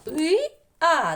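"І, а" called out high and loud, each apart; isolated vowels. Shown as a demonstration. Teacher picking out vowels for children, loud separate "І, а". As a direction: sound out the two vowels "І, а" separately. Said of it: "два було голосних".

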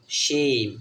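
শেম with sh and a held ei vowel; demonstrated pronunciation. Shown as a demonstration. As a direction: sound out শেম slowly as sheim. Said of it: "এস এইচ এর উচ্চারণকার মতো". (শেম টেনে স্পষ্ট উচ্চারণ)

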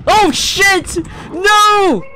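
high panicked screaming. Real, not acted. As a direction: scream in terror. Monster attacks, screaming.

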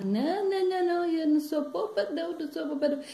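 put-on high-pitched child's protest; singsong, insistent objection; defiant granddaughter. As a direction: refuse in a little girl's high voice. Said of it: "Aí ela".

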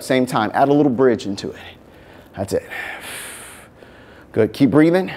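upbeat coaching voice; aerobics instructor. Acted. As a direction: coach the class through the moves. Fitness class coaching.

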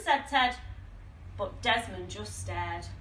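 ordinary delivery

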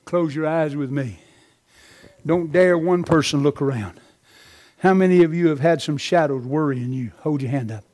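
low, softened preacher's voice; hushed altar call. Real, not acted. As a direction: bring the congregation down into prayer. Leads closing altar call.

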